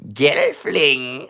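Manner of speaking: drawn-out creature cry; Skeksis. Acted. Streamer playing a Skeksis for viewers, creature cry. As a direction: call out as a screeching fantasy creature. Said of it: "I'm a Skeksis".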